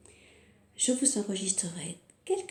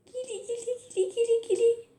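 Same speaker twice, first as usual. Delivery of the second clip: much higher playful squeak; tickly high voice; 13 semitones higher, 5 dB louder, faster than usual. Shown as a demonstration. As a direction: tickle them with your voice.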